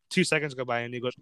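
ordinary talk

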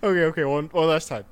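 usual speaking voice

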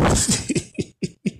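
high, soft giggle; real laughter. Real, not acted. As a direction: giggle at your own joke. Laughs at the horror comparison.

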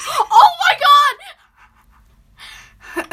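shocked yelp at icy cold; gasping from cold. Real, not acted. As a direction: gasp out from the ice. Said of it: "It's so cold".

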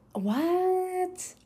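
a high, drawn-out what in disbelief; incredulous what. Retold or imitated. Remembering the moment she doubted her belief, incredulous. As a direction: hear something absurd and balk at it.

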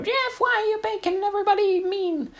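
put-on very high squeaky kid voice; whiny little brother. Imagining little Johnny's high whine at big brother Jeff. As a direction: whine it like a hurt little boy. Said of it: "he'll probably go".

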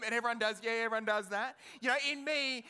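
normal talking voice

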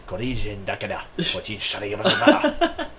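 lightly voiced fake Japanese; mock Japanese gibberish, slowed. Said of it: "good at fake Japanese".

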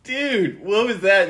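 high, incredulous "what was that"; mock shocked dude. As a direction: play a baffled dad catching the mess. God scolding the messy kid.

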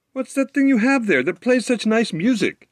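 a tiny forest sprite asking eagerly; curious wood sprite. Acted. A wood sprite asks the fiddler about his fiddle, high curious voice. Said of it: "inquired the sprite".

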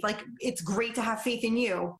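ordinary talk, nothing marked

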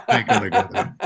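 dad's line dissolving into laughter; laughing quote. Retold or imitated. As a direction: crack up while quoting dad. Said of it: "that's the way he talked".

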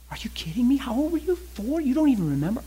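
high-pitched, incredulous questioning; shocked disbelief. Acted. Wife reacting to her husband's revelation, shocked disbelief. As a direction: fire questions in a high, astonished voice.

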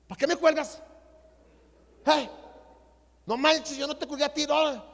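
high, loud indignant outburst; retold angry protest. Brothers argue over hanging up, imitated anger. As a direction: confront him for hanging up, re-enacted. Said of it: "Yo estaba bien enojado".

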